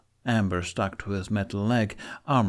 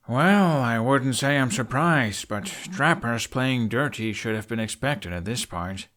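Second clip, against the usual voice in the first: dry drawl; 3 semitones higher than usual. A narrator's reading. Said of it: "Hiccup drawls".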